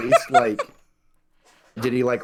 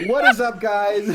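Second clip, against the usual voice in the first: vlogger intro; 7 semitones higher, 5 dB louder than usual. Retold or imitated. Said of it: "Just vlogging".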